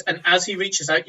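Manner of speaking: ordinary delivery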